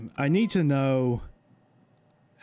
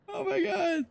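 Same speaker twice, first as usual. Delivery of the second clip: scared gasp; 4 dB quieter than usual. Real, not acted. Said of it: "I am so freaking scared".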